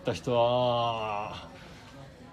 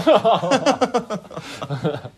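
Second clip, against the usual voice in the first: laughing; 5 semitones higher, 6 dB louder, faster than usual. Real, not acted. Laughing at a pun.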